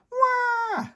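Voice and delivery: loud, high wailing cry; toddler wail. A narrator's reading. George wailing in bed, loud high cry. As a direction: let out a big toddler wail. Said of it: "cries George".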